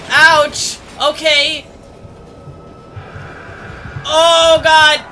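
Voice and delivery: sudden scared cries, high and loud; panicked screaming. Real, not acted. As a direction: yelp in fright, then urge on. Jump scare by the monster.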